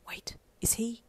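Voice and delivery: whispered disbelief; whispered thought. A narrator's reading. Inner thought whispered by reader.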